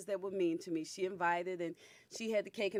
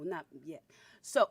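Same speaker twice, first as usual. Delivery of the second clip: normal talking voice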